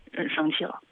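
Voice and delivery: ordinary talk, nothing marked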